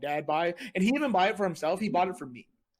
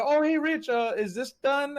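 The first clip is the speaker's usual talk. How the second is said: high, sweet fake-innocent ask, higher and slower; mock innocent question; 9 semitones higher, slower than usual. Friends tricking Rich into cooking, mock innocent. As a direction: play dumb to con him into cooking.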